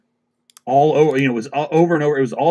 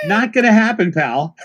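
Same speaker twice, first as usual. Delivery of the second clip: high, loud refusal breaking into a laugh; laughing as he refuses; laughing retort; 8 semitones higher, 4 dB louder than usual. Retold or imitated. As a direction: laugh out the brush-off. Retold reply to a scammer.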